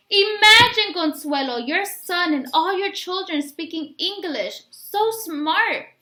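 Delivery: a village woman gushing praise to the mother. Acted.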